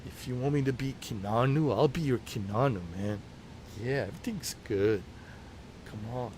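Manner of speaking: low, laid-back Keanu Reeves voice; Keanu impression. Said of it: "I was doing it like".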